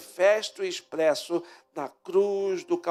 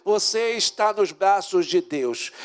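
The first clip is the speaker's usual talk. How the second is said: fervent preaching; 3 semitones higher, 4 dB louder than usual. Acted. Preacher assuring the congregation of God's love, fervent and rising. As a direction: preach it with mounting passion. Preaching builds to full force.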